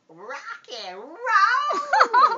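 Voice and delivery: loud high slow laughing whoop; rock and roll cry. A narrator's reading. Dad reading the cat's catchphrase, whooping. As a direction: belt it with a laugh.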